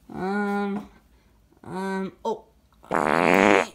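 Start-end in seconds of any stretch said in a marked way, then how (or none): 2.9-3.7 long, loud, low fart sound; fart noise. Pretend. Red Yoshi farts while hunting for food, loud fart noise. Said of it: "I farted".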